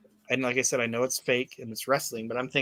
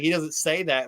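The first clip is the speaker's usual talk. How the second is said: ordinary talk, nothing marked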